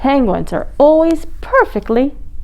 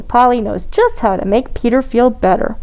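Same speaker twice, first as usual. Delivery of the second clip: usual speaking voice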